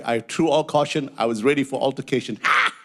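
ordinary delivery

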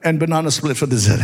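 rising from steady to high, shouted preaching; building preaching. Acted. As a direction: build the story to a shouting climax.

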